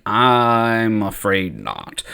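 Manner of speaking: very slow, low, drawn-out reply; reluctant refusal. Acted. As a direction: stretch each word out as you let them down. Qui-Gon reluctantly refuses the child's plea.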